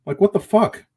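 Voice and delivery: as if finding a stranger right now; shocked disbelief. Real, not acted. Finding a stranger asleep in her car, startled disbelief. Finds a man sleeping in car.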